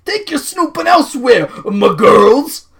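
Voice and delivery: loud, high hammed-up guard. Guard ordering the women out, loud hammy voice. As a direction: bellow it in a put-on character voice. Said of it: "says the guard".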